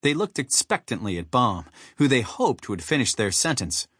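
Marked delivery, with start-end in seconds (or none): none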